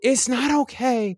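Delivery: high-pitched retold protest; upset retort. His upset retort to her comfort.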